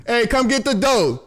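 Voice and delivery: high-pitched call across the house. Real, not acted. Breaking off the video to summon Kaylani, calling out. Calls someone to the dog mid-video.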